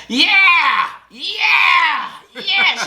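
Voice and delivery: hammed-up girlfriend going off; mock ranting woman.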